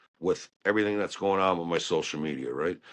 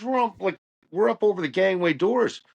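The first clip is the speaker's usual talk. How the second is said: mock yelling; 10 semitones higher, 3 dB louder than usual. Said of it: "he's yelling and screaming at me".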